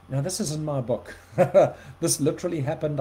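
ordinary delivery

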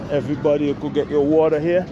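ordinary talk

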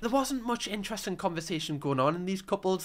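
ordinary talk, nothing marked

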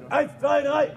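loud count to three; shouted count. Shown as a demonstration. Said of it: "Auf drei alle zusammen".

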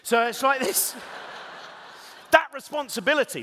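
loud, high and emphatic; big comic outburst. Real, not acted. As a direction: hammer the punchline home. Big comic payoff, laughing.

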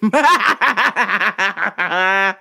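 long rolling belly laugh; hearty laughter. Real, not acted. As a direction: burst out laughing at your own joke. Cracking up at own joke.

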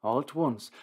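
usual speaking voice

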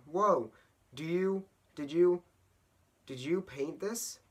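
acted wide-eyed amazement; amazed. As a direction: start over, awestruck by what you see. Ted amazed by Audrey's painting.

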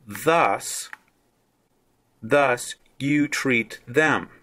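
thus you treat them, slow and deliberate; careful slow translation reading; slow emphatic translation. Shown as a demonstration. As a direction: read it slowly and weigh each word. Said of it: "this is how I would translate this part of it".

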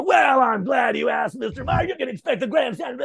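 hammed-up rock frontman holding court. Imitating [name] answering his question.

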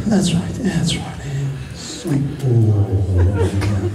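low, soft hypnotist murmur; hypnotic induction. Acted. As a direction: talk them gently down into deep sleep. Hypnotic induction, seating and deepening.

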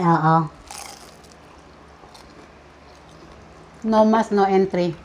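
chewing while talking; talking between bites; mouth full of spring roll. Real, not acted.

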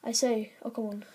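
ordinary delivery

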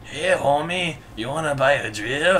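Mexican-American sitcom dad voice; casual salesman homie voice; George Lopez impression. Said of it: "Says George Lopez".

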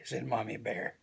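ordinary delivery

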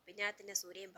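ordinary delivery

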